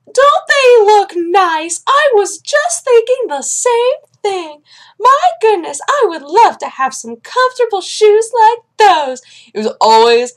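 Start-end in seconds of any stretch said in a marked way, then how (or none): 0.1-9.2 put-on very high, slow old-lady coo; gushing old lady. Old lady agrees about the shoes, gushing high. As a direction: imitate a delighted old lady, high and drawn out. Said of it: "some old lady that was like".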